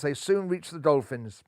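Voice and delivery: plain reading voice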